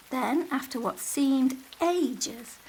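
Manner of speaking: ordinary delivery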